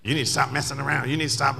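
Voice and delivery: lightly acted quick telling-off; fussy retort. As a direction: imitate someone getting fussy, rattled off fast. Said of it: "got fussy with me".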